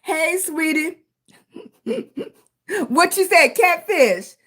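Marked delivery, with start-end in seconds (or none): none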